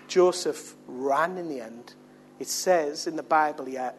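ordinary talk, nothing marked

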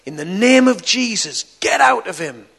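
loud, slow, high commanding shout. Retold or imitated. Preacher shouting at the demon, loud and high. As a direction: cast it out at full voice. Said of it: "he shouted and said".

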